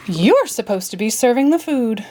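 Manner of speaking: plain reading voice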